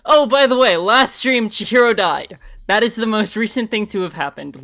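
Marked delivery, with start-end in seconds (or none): none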